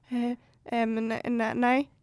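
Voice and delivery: soft, stammering no; hesitant refusal. Retold or imitated. Boy turning down the recruiter, soft and stammering. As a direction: stumble quietly towards saying no.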